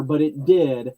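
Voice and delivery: ordinary talk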